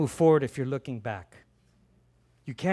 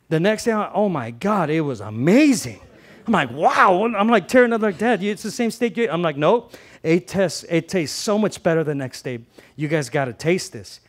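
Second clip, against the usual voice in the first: excited rave; 2 semitones higher, 7 dB louder than usual. Retold or imitated. Pastor retelling his leftover steak to the congregation, loud and gushing. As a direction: rave about it like it's the best thing ever.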